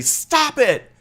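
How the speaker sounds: put-on child yelling out. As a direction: cry out at the kid who keeps poking. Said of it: "And you yell out and say".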